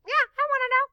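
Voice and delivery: high, bright little bear voice; the toy bear begs to know, pitched high; eager little bear voice. Pretend. Puppet bear replies eagerly.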